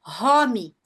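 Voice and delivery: "home" as Brazilian "rómi", open o and added i; two-syllable Brazilian-accented "home"; mispronounced home. Retold or imitated. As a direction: imitate a learner saying "rómi". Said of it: "pessoas o tempo todo falando".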